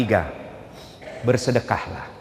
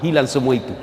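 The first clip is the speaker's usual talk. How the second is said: normal talking voice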